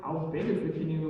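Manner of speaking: lightly voiced low father; a hint of skeptical dad; disapproving dad. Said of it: "He's like".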